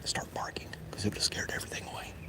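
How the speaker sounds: hushed whisper listening for deer; barely voiced whisper. Real, not acted. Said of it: "That's why I'm talking low".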